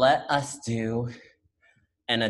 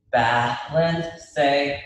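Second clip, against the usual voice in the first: ordinary talk, nothing marked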